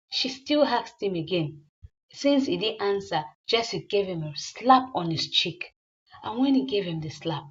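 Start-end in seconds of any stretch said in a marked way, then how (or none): none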